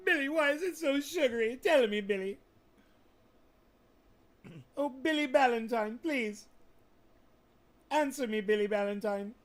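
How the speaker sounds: high, loud pleading to Billy; pleading call. Pretend. Pleading with Billy Ballantyne in a loud, high call. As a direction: beg Billy loudly, voice up high.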